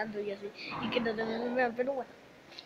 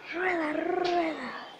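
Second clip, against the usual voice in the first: playful singsong; 5 semitones higher, 4 dB louder, much slower than usual. Real, not acted. Brother rolling on floor.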